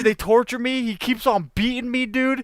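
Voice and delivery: ordinary talk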